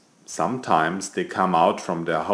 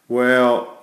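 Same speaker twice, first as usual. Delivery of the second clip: plain reading voice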